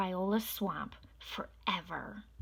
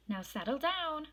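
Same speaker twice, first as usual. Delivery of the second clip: sweet voice; 8 semitones higher than usual. A narrator's reading. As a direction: gently coax the class to calm down. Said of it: "said Miss Nelson in a sweet voice".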